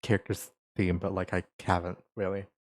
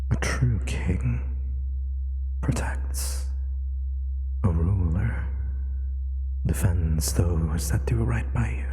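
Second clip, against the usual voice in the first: whispered regal king's voice; slower than usual. Acted. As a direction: speak like a king heard inside the head. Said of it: "a regal voice in the back of your head".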